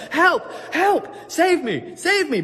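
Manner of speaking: high, loud calls to be saved; desperate shouts. Acted. Castaway shouting at the helicopter overhead, high and loud. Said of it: "he's still saying the same words".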